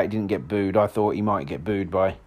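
ordinary delivery

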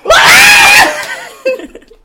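very high, loud shriek; banshee wail. Shown as a demonstration. Host wailing on cue, banshee shriek. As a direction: let out a piercing banshee wail. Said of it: "And cue banshee".

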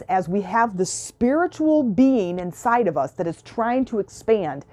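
ordinary talk

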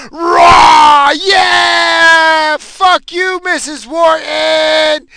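very high, full-volume cheering yell; roaring crowd. Retold or imitated. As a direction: imitate a whole cafeteria roaring its approval. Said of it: "It was a roar, like".

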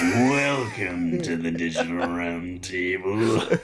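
low, slow menacing welcome; villain voice. Shown as a demonstration. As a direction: play the smug supervillain host. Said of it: "you should be like".

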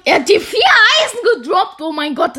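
high loud excited call. Real, not acted. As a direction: gasp out the lucky drop. Rare loot drop.

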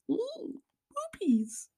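put-on very high, drawn-out baby talk; tiny falsetto goofing; silly squeal. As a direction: squeak it slowly like a giddy toddler. Silly aside about pooping.

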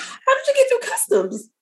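high, amazed question; mock incredulity. Host asking how relatives got through customs, high and amazed. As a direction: marvel at the smuggling, half laughing.

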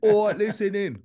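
high, loud mock English military accent; put-on English accent. Recruit addressing the troops in a put-on English accent. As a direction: call it out in an imitated English accent. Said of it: "he made me speak like that".

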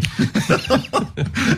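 high airy laugh; a quick amused laugh at the joke; breathy laugh. Real, not acted. Laughs at the pastor's saying.

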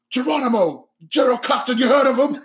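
put-on high, indignant British accent; pissed-off British gangster. Imitating a gangster scolding gunless men, pissed-off British accent. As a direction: quiz them scornfully in put-on British. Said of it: "he's pissed off".

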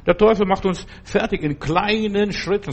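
ordinary talk, nothing marked

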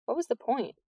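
usual speaking voice

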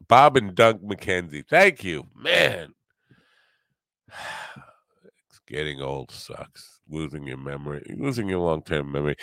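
normal talking voice

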